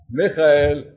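ordinary talk, nothing marked

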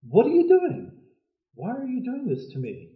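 the donkey protests to Balaam in a high squeal; talking donkey. Acted. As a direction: pitch it way up like an indignant animal. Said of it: "the donkey turns around and says".

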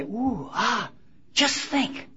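mock the crowd fawning over a celebrity; imitating admirers gushing softly; awed gushing crowd. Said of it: "everybody say".

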